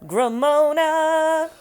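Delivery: high, very slow stretched words; slow drawn-out mock voice. Friends riffing on the wine, high and slow. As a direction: drag each word out like a parody.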